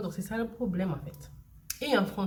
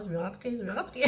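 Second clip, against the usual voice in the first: laughing through a homesick plea; half-laughing, pleading to go home; 3 semitones higher than usual. Real, not acted. Mother longing for home, laughing it off.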